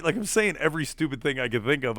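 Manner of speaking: ordinary talk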